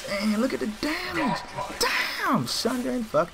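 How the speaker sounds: high-pitched excited outburst; squealed gamer amazement; amazed exclamation. Real, not acted. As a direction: cry out, thrilled by the damage. Amazed at damage done in game.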